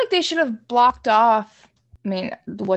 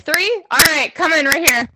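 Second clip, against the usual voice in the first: higher, louder, herding voice; ride attendant; 6 semitones higher, 3 dB louder than usual. Pretend. Attendant loading a roller coaster ride, brisk calling. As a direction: call riders in like a coaster operator. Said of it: "beginnings of a roller coaster ride at Hershey Park".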